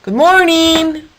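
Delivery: high, slow drawn-out greeting; called greeting. Acted. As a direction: stretch it to reach the goat. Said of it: "called out again".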